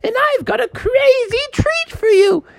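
put-on high crackly old lady handing out carrots. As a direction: dote on the kids like a granny. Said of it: "it was an old lady".

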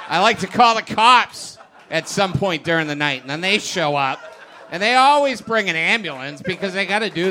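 loud, fast frantic shouting, laughing through it; angry rant. Acted. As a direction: bellow it like an unhinged husband. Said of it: "he sounded really angry and really upset".

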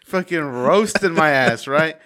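loud, high, laughing retelling; cracking up. Real, not acted. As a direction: get the words out through real helpless laughter. Cracking up at niece's insult.

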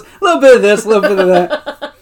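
high, loud, half-laughing imitation; mock evasive answer. Dwight admits things about the barber, mock evasive answer.

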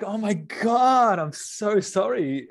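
drawn-out, high-pitched re-enacted dismay; slow, emphatic retold horror; shocked sympathy. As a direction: stretch out the apology, voice lifted in shock. Retells his shocked sympathy.

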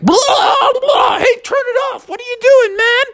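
very high, shouted protest; mock spluttering outrage. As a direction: yell through a blast of hose water. Bear sprayed in face with hose.

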